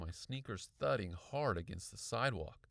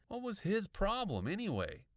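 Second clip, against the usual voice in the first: higher-pitched, irritated question; indignant; 10 semitones higher, 4 dB louder than usual. A narrator's reading. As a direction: fume to yourself. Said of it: "I was really angry".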